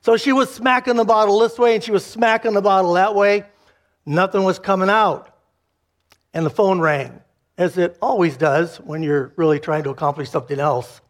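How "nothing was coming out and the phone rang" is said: usual speaking voice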